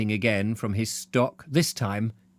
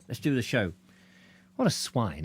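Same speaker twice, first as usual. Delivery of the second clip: ordinary delivery, nothing marked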